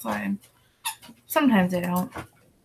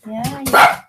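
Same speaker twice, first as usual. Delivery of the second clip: usual speaking voice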